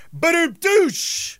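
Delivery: high, slow imitated drum sting; vocal rimshot. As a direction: punctuate the joke with a mouth drum. Imitates rimshot after pun.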